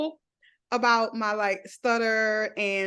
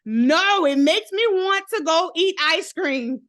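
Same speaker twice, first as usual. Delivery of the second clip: emphatic; 6 semitones higher, 6 dB louder than usual. Real, not acted. Emphatic loud protest.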